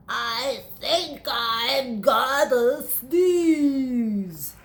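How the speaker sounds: very slow, strained pre-sneeze voice; about to sneeze. Acted. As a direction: draw it out like a sneeze is coming.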